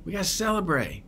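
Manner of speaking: high, soft, coaxing father's voice; gentle father. Retold or imitated. Father coaxing his sulking elder son, high and gentle. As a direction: coax him in tenderly. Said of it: "He says".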